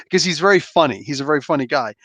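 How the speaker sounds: usual speaking voice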